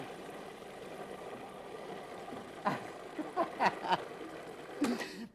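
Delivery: breathy laugh. Real, not acted. Helper shows card upside down.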